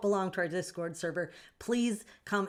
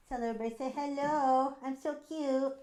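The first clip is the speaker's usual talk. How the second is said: higher, cutesy pet voice; voicing the pet; 8 semitones higher than usual. Streamer speaking for her pet to chat, high cutesy pet voice. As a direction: speak as the cat showing off to chat.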